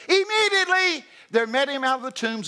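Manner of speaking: high loud burst; preaching shout. Acted. Preacher exclaiming to the church, high and loud. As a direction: punch the word out.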